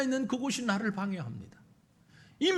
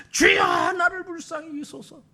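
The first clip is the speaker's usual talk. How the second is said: desperate outcry; 6 semitones higher, 3 dB louder than usual. Acted. Crying out despite the crowd.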